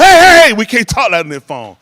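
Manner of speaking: loud high yell, easing into loud warning; alarmed interrupting shout. Retold or imitated. Friend stopping talk on the phone, alarmed shout. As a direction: mimic a friend frantically cutting in. Friend cuts him off, acted.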